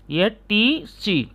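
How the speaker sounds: टीसी drawn out very slowly, each letter clear; teacher stretches टीसी slowly so students hear the T; slow modelled TC. Shown as a demonstration. Teacher slowly spells out टीसी for the class. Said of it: "जैसे मैंने कहा".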